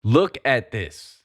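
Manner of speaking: slow, heavy emphasis; slow and pointed. Real, not acted. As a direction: lay each word down slowly.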